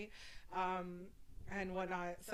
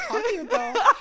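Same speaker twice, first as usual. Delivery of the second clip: laughing; 10 semitones higher, 9 dB louder, faster than usual. Retold or imitated. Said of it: "she's like".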